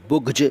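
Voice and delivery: बुग़्ज़ said as "bugj", hard g and final j; common mispronunciation. Shown as a demonstration. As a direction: end बुग़्ज़ on a j sound, with plain g. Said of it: "इसको कुछ लोग".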